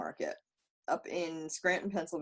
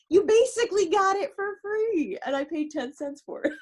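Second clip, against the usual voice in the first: high, loud indignant protest from the sister; mock outrage, voicing the sister's complaint; fuming sister; 12 semitones higher, 7 dB louder than usual. Sister fuming at the flea market, high loud mock outrage. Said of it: "my sister is fuming".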